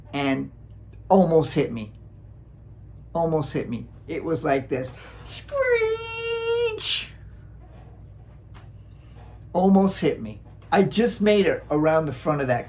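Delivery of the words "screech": tire screech. Retold or imitated. Imitating car brakes.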